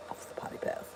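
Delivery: whispered aside; soft whispered remark about the dog. Real, not acted. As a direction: breathe it, no voice.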